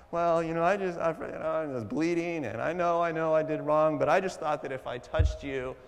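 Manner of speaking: a shy woman owning up; timid woman. Retold or imitated.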